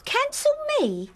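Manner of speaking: high, outraged disbelief; sharp indignant question. Acted. Daughter outraged at being cancelled.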